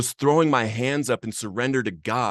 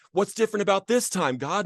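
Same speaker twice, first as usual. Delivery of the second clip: retold yell at God; 10 semitones higher than usual. Alone in a borrowed car, crying out at God, high anguished cry. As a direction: hurl the question up at God, pitch high. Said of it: "I'm yelling at him".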